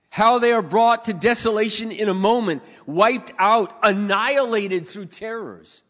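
loud, slowing scripture proclamation; preacher reading the psalm aloud, loud and slowing; full-force preaching. Acted. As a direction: draw out annihilated, heavy and slow.

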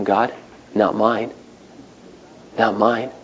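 soft, low quoted prayer to God; hushed prayer. Retold or imitated. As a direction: pray it quietly, almost to yourself.

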